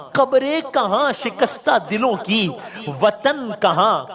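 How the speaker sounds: sing-song lament, drawing out each line; elegy recitation. Acted.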